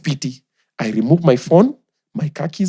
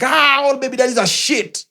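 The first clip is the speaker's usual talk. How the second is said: mock bitter baby mama; 10 semitones higher than usual. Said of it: "They're like".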